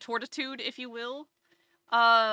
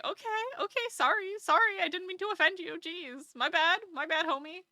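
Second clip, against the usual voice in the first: higher, placating sorry; apologetic owner; 8 semitones higher than usual. Retold or imitated. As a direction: back off sheepishly. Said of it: "we're like".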